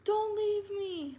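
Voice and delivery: high, slow, wailing plea; mimicking an ex's high drawn-out wail of don't leave me; mock pleading scream. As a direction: imitate a clingy man begging, drawn out high. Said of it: "Like, screaming, like, very loudly".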